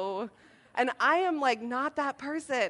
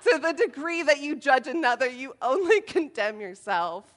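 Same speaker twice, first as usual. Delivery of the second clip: loud Bible verse; 3 semitones higher, 8 dB louder than usual. Retold or imitated. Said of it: "followed it up with a Bible verse".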